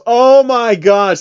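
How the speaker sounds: slow, loud cringe; cringing. Real, not acted. Reader cringing at the dated joke. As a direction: groan at how dated it is.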